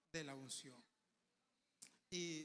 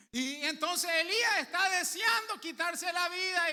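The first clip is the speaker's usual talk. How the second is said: preaching at full force; 8 semitones higher, 14 dB louder than usual. Acted. Preacher tells congregation what God does, at full force.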